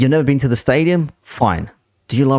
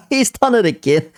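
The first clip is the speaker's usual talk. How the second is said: laughing; 10 semitones higher than usual. Real, not acted. Laughing at replayed clip.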